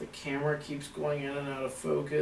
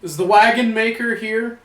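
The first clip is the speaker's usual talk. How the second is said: loud, raised call to an unseen presence; 8 semitones higher, 13 dB louder than usual. Real, not acted. Ghost hunter addresses the wagon maker spirit, calling out. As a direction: call out higher and much louder, as a question.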